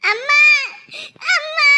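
tearful call for mother; wailed cry to mum. Acted. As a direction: call out sobbing. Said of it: "என்னடி அழுவுற".

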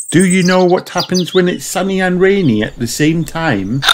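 lightly voiced gentle mother; a mother quizzing her child kindly; a hint of Mummy Pig. Mummy Pig asking Peppa a riddle, lightly voiced.

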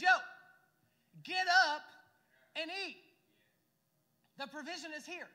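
loud, casual call of an angel to a tired prophet; Yo, then a sudden loud get up. Retold or imitated. Angel rousing the worn-out prophet, loud and offhand. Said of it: "and the angel says".

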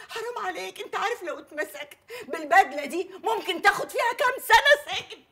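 high, exasperated scolding. Acted. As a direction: scold him, worn out and fed up. Scolding her son. (توبيخ عالي بضيق)

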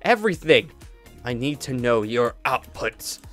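ordinary delivery

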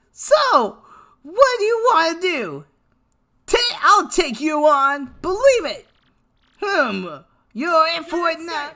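high, cocky cartoon hero; Naruto. Streamer reading the character taking on a challenger, high Naruto impression. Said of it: "I gotta do my Naruto voice".

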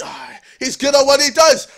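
ordinary delivery

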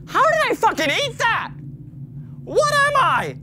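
high squeaky disbelief. Real, not acted. Mock outrage at eating.

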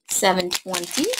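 ordinary talk

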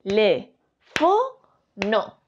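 te, le, fo, no split apart with pauses; each syllable said on its own. Shown as a demonstration. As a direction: break the word into separate syllables. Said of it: "Si dividimos las sílabas".

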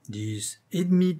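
insistent. A narrator's reading. Comedienne corrects her lover on the hour, insistent. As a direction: spell it out to him insistently.

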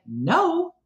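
drawn-out no, swooping up high; mock-patient refusal, pitched well up; imitated refusal. Keith answering the would-be skier, high drawn-out mock refusal. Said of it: "And Keith's like".

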